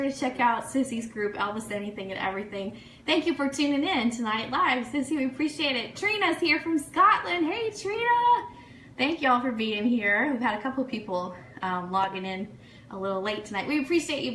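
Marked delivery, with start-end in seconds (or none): 5.9-8.5 excited greeting. Real, not acted. Host greets viewer from Scotland.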